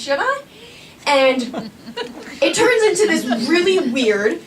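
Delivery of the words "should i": pitched way up, nervous and pleading; high-pitched fretful question; anxious high squeak. Retold or imitated. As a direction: squeak it out like a worried teen. Anxiously asking friend about boy.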